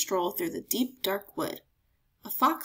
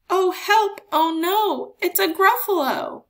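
high, loud alarmed cries; panicked cry; 9 semitones higher, 8 dB louder than usual. Acted. Mouse crying out in fright at the Gruffalo. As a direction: squeal it in terror.

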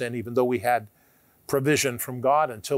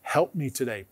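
prayer; 6 semitones lower, 7 dB quieter than usual. Shown as a demonstration. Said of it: "we say".

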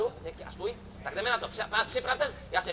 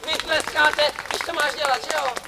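crowd hype; 3 semitones higher, 5 dB louder than usual. Acted. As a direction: whip up the applause. Rouses audience to clap. (hlasité burcování publika)

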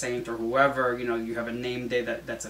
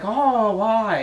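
higher, louder imitated shocked "Oh, why?"; mock surprise; 8 semitones higher, 6 dB louder than usual. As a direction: pitch it up as the startled coworker. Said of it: "she was like".

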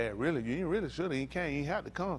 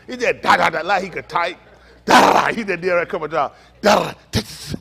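typing noises; 5 semitones higher, 12 dB louder than usual. Retold or imitated. Mimicking fast typing sounds.

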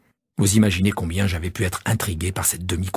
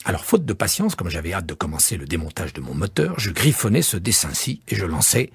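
usual speaking voice

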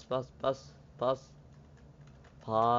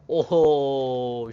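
amazed shout; 4 semitones higher, 10 dB louder than usual. Real, not acted. Amazed at a move.

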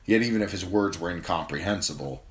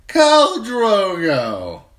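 much higher, much louder, slow; thick drunken call; 12 dB louder, much slower than usual. A narrator's reading. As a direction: call out to the khal, slurred. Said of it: "he said thickly".

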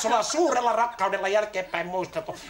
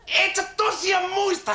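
high, drawn-out incredulous cry; 6 semitones higher, slower than usual. Acted. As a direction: stretch it out in shocked disbelief. Son appalled father forgot him.

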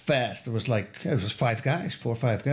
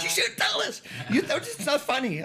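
high mock complaint from his wife; 13 semitones higher than usual. Husband imitating his wife's complaint about the story, high. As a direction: pitch up to play the annoyed wife.

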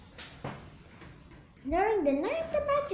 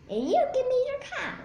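high, odd little-man voice; funny little man; 11 semitones higher, 5 dB louder, faster than usual. Acted. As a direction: squeak it out like a strange little trickster.

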